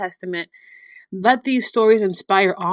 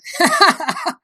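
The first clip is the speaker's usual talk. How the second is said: a high, sudden burst of real laughter; belly laugh; 12 semitones higher, 3 dB louder than usual. Real, not acted. Preacher laughing at the joy of the resurrection, real belly laugh. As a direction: laugh out loud, delighted. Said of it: "It makes me belly laugh".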